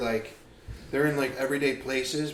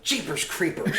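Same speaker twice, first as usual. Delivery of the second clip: put-on wholesome 1950s kid; 5 semitones higher than usual. Old-timey exclamations bit.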